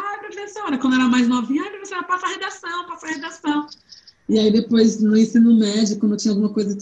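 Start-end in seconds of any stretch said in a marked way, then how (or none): 0.0-0.8 high, soft, whining plea; whiny pleading kid. Retold or imitated. Alunos pedindo redação à professora. 1.6-3.7 high, soft and quick whining plea; whiny pleading kid. Retold or imitated. Alunos pedindo redação à professora.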